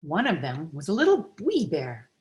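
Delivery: ordinary delivery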